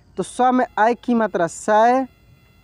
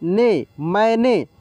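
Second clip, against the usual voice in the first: slow syllable reading; much slower than usual. Shown as a demonstration. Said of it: "म में आय की मात्रा मैं".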